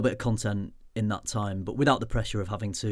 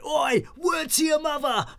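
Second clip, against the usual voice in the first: mock shouted greeting; slower than usual. Fan shouting across the arrivals lounge at the YouTuber, mock yell. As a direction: yell it out like a fan spotting him. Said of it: "and they went".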